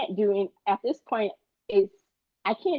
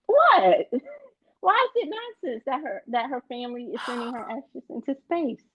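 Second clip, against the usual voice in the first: stunned disbelief, rising fast; incredulous outburst; 8 semitones higher than usual. Real, not acted. Co-host scoffs, he protests.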